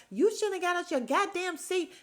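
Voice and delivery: hammed-up voice of a scolding friend; imitating a scolding. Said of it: "and she's like".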